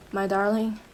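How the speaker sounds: ordinary talk, nothing marked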